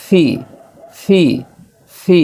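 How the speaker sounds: "ফি" with a puff like ফ, each one separate; aspirated letter-name P. Shown as a demonstration. Teacher drilling learners on P, "ফি" aspirated. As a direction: repeat "ফি" with breath released after p. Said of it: "ফর মতো হবে".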